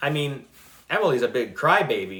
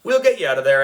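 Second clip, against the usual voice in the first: shouting reassurance; 3 semitones higher, 7 dB louder, much faster than usual. A narrator's reading. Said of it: "shouted Miss Child".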